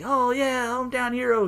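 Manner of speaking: high, mock-cheery hype voice; sarcastic hype. Mocking how the company hypes a hometown boy, high and sarcastic. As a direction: fake excitement, dripping sarcasm.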